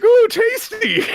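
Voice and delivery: very high, loud gleeful squeal; squeaky laughing Denji. Retold or imitated. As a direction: squeal it through laughter like a delighted kid. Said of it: "And he's like".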